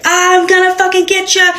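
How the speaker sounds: high mock menacing threat; lightly voiced crazy neighbor taunting; imitating crazy neighbor. Neighbor taunting her through the walls, mock menace. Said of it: "he's laughing, and he's screaming".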